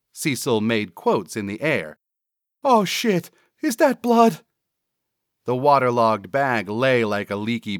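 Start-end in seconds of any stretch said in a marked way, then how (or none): 2.6-4.4 high, loud sidekick alarm. Acted. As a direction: blurt it out in a high, startled voice. Cecil sees blood.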